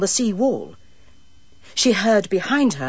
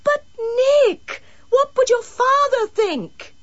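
desperate pleading; 3 dB louder, slower than usual. A narrator's reading. Desperate woman pleading with boy.

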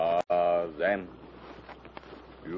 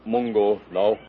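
usual speaking voice

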